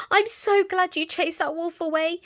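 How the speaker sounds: put-on high, happy little girl; child voice. A narrator's reading. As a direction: say it like a relieved girl. Little Red voiced, relieved.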